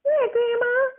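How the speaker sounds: high squeaky imitation of himself as a kid. Grandson imitating his child self answering grandma, high and squeaky. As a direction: pipe it up like a little boy. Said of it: "And I was like".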